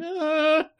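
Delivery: high, thin mock whimper; helpless "ah". Voicing the wrestler standing by, helpless high noise. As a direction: imitate a useless high whine.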